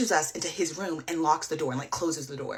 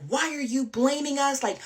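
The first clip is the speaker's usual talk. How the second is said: high-pitched imitation of his defensive yelling; retold defensive outburst, pitched up; mock screaming back; 8 semitones higher than usual. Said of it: "He's screaming back at me".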